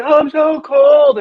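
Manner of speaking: mock the kids whining about the cold; childish mock whimper drawn out; whiny kids. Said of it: "They're like".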